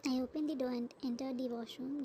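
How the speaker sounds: ordinary delivery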